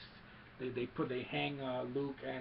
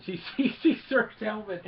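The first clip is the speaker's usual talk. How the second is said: words bubbling up louder and higher through laughter; real laughing; 7 semitones higher, 9 dB louder than usual. Real, not acted. Retelling the helmet scene to viewers, laughing through the words. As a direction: crack up in the middle of telling it.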